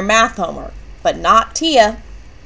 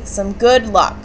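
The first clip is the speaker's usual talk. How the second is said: usual speaking voice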